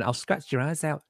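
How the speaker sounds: lightly imitating a growl while re-telling it; mock beast growl. Retelling the growl to new viewers, a quiet mock growl. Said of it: "to see me going".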